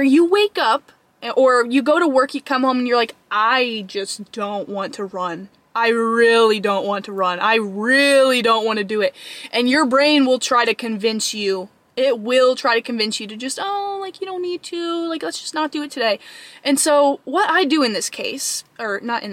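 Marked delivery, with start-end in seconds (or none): 3.3-9.1 heavy, unwilling groan on stretched vowels; reluctant whining. Acted. Acting out reluctance to run. 13.6-16.2 higher, softer wheedle; little pleading voice talking you out of it; soft, high excuse-making; coaxing inner voice. Acted. Voicing the brain's coaxing excuse.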